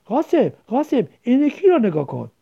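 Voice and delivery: eager little sister showing off her find; excited call to her brother. Acted. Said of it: "هیجان زده گفت".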